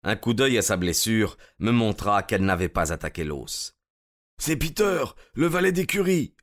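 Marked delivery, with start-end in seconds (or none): 4.4-6.3 high-pitched, alarmed exclamation; crying out. A narrator's reading. Stranger finding the wounded stable boy, crying out. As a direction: cry out on recognising him. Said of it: "s'écria l'étranger".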